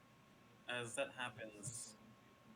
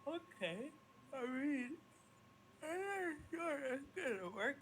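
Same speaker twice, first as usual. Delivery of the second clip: high, unsure tiny fairy; doubtful fairy voice. Acted. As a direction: sound unconvinced as the fairy. GM voicing doubtful Tinkerbell.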